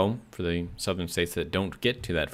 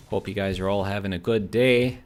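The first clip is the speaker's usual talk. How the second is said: lilting Yooper well-wishing, a bit louder; folksy Upper Peninsula Yooper accent; 5 dB louder than usual. Shown as a demonstration. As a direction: wish them well like a Yooper. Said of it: "go with the Yooper accent".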